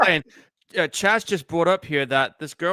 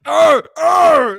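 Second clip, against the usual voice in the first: two loud, high "Arr!" yells; imitated pain cries; 11 semitones higher, 11 dB louder than usual. Co-host mimicking Jason in pain, loud cries. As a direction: mimic the slasher's hurt roar. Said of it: "when he screams in pain".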